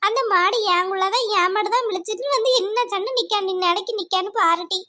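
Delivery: ordinary talk, nothing marked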